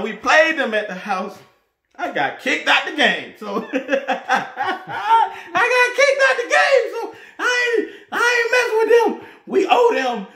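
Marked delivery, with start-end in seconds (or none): 3.4-6.9 high, slow, laughing shout; cackling disbelief at his own ejection. Real, not acted. Repeats it laughing, much higher. 6.9-10.3 high-pitched laughing shout; cracking up at the rivalry. Real, not acted. Keeps laughing about the rivals.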